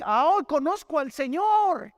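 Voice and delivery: drawn out like someone showing off what he thinks he knows; mock proud claim. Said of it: "yo puedo decir".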